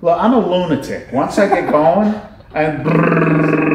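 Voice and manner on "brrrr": rolled "brrr" revving sound; rapid rolled brrr; imitated motor noise. Shown as a demonstration. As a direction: trill a brrr like an engine taking off. Imitates his mind racing off.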